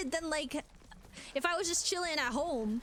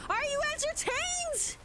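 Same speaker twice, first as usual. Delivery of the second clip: much higher, slow, drawn out; mock gladiator question; 11 semitones higher, slower than usual. Streamer teasing boring streamers, high and hammy. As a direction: ham it up like a showman.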